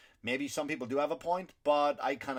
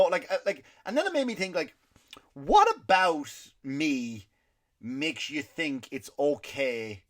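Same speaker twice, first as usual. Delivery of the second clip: normal talking voice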